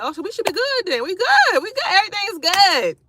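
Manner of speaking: high, excited reassurance; relieved delight. Retold or imitated. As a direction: cheer that it's all fine. Relieved there is no mold.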